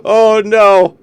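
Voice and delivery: high laughing, slow and loud; laughing hard. Real, not acted. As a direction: break down laughing at the clip. Cracks up at Bub compilation.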